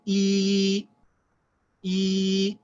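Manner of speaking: E as a high, sustained ee; long vowel. Shown as a demonstration. Teacher showing the long vowel on the alif, sustained. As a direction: hold E long and level. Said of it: "say the long vowel on the alif".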